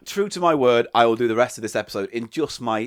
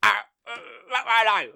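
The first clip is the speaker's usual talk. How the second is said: whiny impression; 5 semitones higher than usual. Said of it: "impression show".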